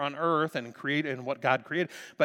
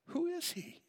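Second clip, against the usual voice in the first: awed question; 5 dB quieter than usual. Real, not acted. Personal awe.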